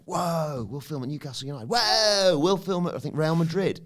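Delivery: high, excited mock producer pitching locations; hammed-up producer boasting about big clubs. Podcaster mocking the film's producers, high and excited.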